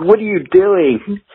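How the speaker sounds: high, slow drawn-out disbelief; incredulous. Retold or imitated. Guitarist watching tape cut, high slow mock disbelief. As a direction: stretch it out in mock shock. His own incredulous reaction retold.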